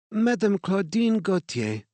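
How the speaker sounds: a gracious French director's greeting; French accent. A narrator's reading. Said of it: "her English marked with a French accent".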